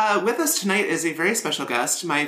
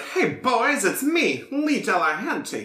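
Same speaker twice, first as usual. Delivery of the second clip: higher, swooping impression of a friend; Lee; 7 semitones higher, slower than usual. Teasing a co-host with an impression, swooping high voice. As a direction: exaggerate the rising and falling tune. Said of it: "my impression of Lee is like".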